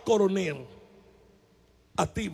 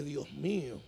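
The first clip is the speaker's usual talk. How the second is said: low, much softer "Dios mío" under the breath; a dread-filled aside to himself; frightened prisoner mutters to God; scared murmur; 8 semitones lower, 11 dB quieter, slower than usual. Retold or imitated. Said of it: "yo dije".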